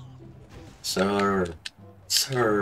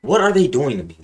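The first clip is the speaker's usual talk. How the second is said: louder, higher plea; exasperated complaint; 5 semitones higher, 7 dB louder than usual. Real, not acted. Gamer complaining the game gives him no matches. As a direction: protest loudly at the game.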